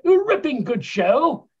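drawn-out, posh English gent impression; British accent, lightly hammed. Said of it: "they really do talk like that".

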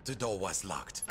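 ordinary talk

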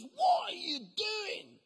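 high, slow, disapproving minister; minister's scolding. Retold or imitated. Minister scolds Mark after his show, drawn-out disbelief.

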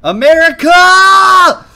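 high, drawn-out victory holler; huge celebratory shout, very high and loud; triumphant yell. Real, not acted. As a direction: bellow it like a rowdy patriotic cheer. Celebrates success in the game.

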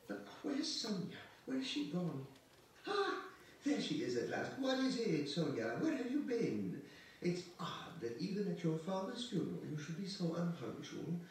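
high, loud, insistent woman's voice; agitated scolding hostess. Acted. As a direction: fuss loudly at a late guest, pitched high.

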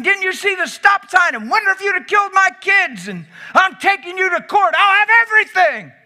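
high, loud furious rant; angry driver yelling. Retold or imitated. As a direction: yell like a wronged driver. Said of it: "He sees people screaming and yelling".

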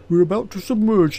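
high, loud guard voice; guard's warning. A narrator's reading. Guard warning passengers before the dive, loud warning. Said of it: "he warned".

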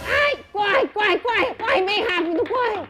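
high, loud hurried cries of corre; urgent urging. Real, not acted. Fleeing the angry parents, high loud urgent cries. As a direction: urge yourself to run, flustered.

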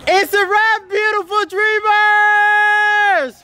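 long joyful shout of it's a wrap; loud film set calls. Real, not acted. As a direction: call out the wrap to the whole crew. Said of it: "I'm gonna say something".

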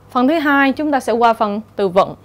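ordinary talk

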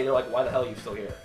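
ordinary talk, nothing marked

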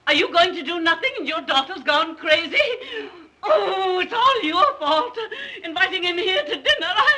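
high-pitched, tearful tirade; hysterical. Acted. Mother blaming her husband for daughter's engagement, hysterical. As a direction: wail the blame, voice breaking into sobs.

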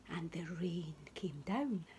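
ordinary delivery, nothing marked

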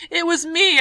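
high, loud desperate shout; shouted confession. Retold or imitated. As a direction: blurt it out to Papa in panic. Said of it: "I shouted".

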